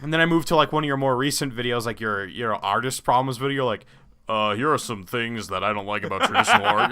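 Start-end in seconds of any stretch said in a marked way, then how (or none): 4.3-6.9 a hint of smooth radio-host voice. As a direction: lightly imitate a radio announcer. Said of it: "the total radio voice now".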